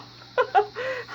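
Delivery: a high giggle at her own joke; high laugh. Real, not acted. Cook laughs at her fake amazement, high giggle. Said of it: "I'm just kidding".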